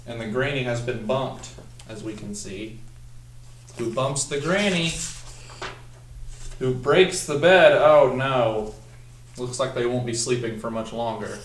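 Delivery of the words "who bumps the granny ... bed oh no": louder and slow as the bed breaks; dramatic climax. Acted. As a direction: land the big crash for the class.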